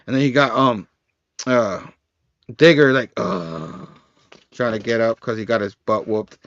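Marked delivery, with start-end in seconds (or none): 3.2-3.9 low, soft groan of effort; mock pained "uh", pitched down; imitated groan. Imitates Digger struggling to get up.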